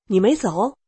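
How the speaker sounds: a touch of startled disbelief; surprised question. Retold or imitated. Tutor coming back, finding her still there, mildly surprised. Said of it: "他吃惊地看着我说".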